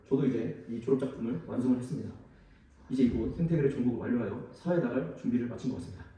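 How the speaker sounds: ordinary talk, nothing marked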